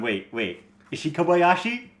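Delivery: ordinary delivery, nothing marked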